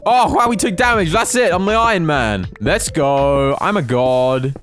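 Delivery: high, loud triumphant cheering; loud gleeful gamer hype; excited yelling. Real, not acted. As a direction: shout it like you just won. First damage, Iron Man achievement.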